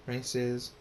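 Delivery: usual speaking voice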